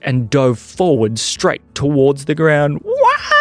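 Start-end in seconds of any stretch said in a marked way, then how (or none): 2.8-3.4 very high, loud wail; passengers' scream. Acted. Passengers plunging towards the ground, long high scream. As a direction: scream as the plane dives. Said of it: "screamed all the passengers".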